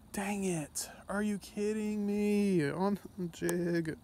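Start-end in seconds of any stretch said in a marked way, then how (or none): none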